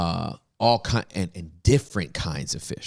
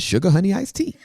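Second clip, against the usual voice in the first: high, loud, laughing minced oath; playful high-pitched euphemism; mock exclamation; 13 semitones higher, 7 dB louder than usual. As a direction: deliver the punchline squealing with laughter. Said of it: "until I said".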